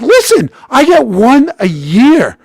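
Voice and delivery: loud, high, drawn-out self-defense; pleading protest. Retold or imitated. Player defending himself to his girlfriend, loud protest.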